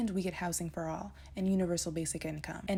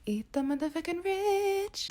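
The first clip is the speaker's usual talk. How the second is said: higher, louder, punching rich; emphatic shout; 11 semitones higher, 5 dB louder than usual. Real, not acted. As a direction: yell the slogan like a rally chant. Slogan repeated emphatically.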